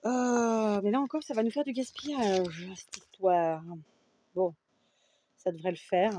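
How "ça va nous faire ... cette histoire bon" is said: usual speaking voice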